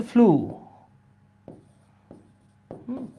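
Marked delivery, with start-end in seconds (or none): 0.0-0.5 ফ্লু said as floo, with a long plain "oo"; corrected pronunciation. Shown as a demonstration. Teacher showing the class how flew sounds, ফ্লু with long "oo". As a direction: hold the vowel of ফ্লু, no "ee" glide. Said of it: "এইটার উচ্চারণ হচ্ছে".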